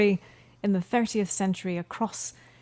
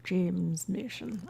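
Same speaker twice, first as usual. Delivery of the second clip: slow, soft attempt at an English actor; James Mason impression; 3 semitones lower, 7 dB quieter, much slower than usual. Musing on the actor's name, tentative drawn-out English voice. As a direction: stretch the name out, lightly mimicking him. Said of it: "I'm not doing an impression".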